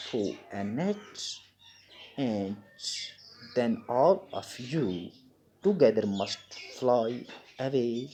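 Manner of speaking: slow, deliberate classroom reading; drawn-out words with long pauses for learners; story read-aloud. Acted. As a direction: launch firmly, then read phrase by phrase. Teacher reads story aloud to pupils.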